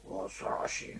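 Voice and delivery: hushed, voiceless whisper of dread; ぞっとしたささやき. Real, not acted. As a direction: let it out as a chilled whisper. 恐ろしい話への反応. (息だけの声で恐ろしそうに)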